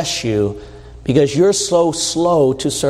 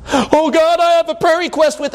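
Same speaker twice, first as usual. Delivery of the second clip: mock eager prayer; 10 semitones higher than usual. Believer voiced praying.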